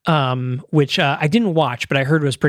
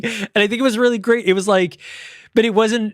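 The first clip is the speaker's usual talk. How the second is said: normal talking voice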